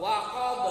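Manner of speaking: Waqab with a loud bouncing b at the end; loud kubro qalqalah on waqab; strong qalqalah. Shown as a demonstration. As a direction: hit the final b of waqab hard, echoing. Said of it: "Karena terakhir wakaf, ... qalaqa-nya kubro".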